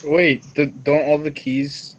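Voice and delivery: ordinary delivery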